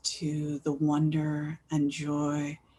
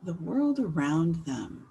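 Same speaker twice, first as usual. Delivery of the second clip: gentle guiding voice with long pauses; guided meditation. Real, not acted. Host inviting everyone to think about the world around them, guided meditation. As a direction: ease listeners in, leaving space after each phrase.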